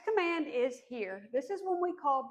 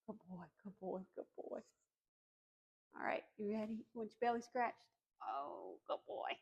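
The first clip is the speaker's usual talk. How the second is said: soft, quiet praise for a puppy; talking to a dog; 3 semitones lower, 12 dB quieter than usual. Real, not acted.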